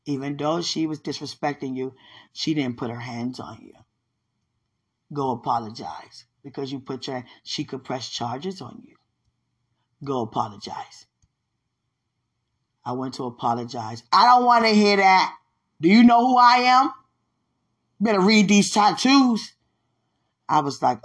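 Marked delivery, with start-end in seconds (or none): 0.0-11.1 low, soft, measured instruction; God's voice. Retold or imitated. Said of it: "God said". 14.1-19.5 loud, high confrontational yell; angry rejection. Retold or imitated. Woman angrily rejects the apology.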